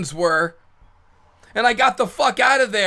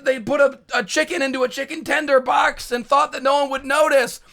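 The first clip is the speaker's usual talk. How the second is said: ordinary talk, nothing marked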